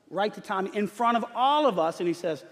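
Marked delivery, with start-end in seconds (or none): none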